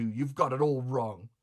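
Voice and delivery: plain reading voice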